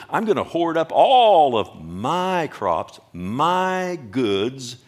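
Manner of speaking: "all" and "my" stretched long and loud; slow, loud, possessive weight on "my"; stress on MY. Acted. Preacher quoting the hoarding rich man, stress on MY. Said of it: "He has a problem with I and my".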